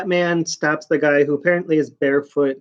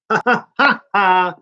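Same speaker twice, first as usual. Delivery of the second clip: laughter; 4 semitones higher than usual. Real, not acted. Laughing at his own remark.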